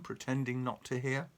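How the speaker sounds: usual speaking voice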